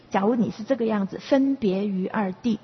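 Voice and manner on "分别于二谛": usual speaking voice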